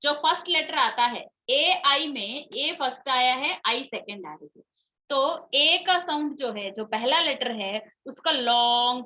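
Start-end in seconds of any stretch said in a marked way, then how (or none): none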